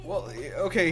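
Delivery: usual speaking voice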